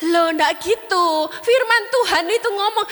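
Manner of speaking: slow, high protesting elder; lightly voiced old pastor insisting; Pak Roni objecting. Elderly father rebuking the young couple, slow and high. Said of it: "Pak Roni bilang gini".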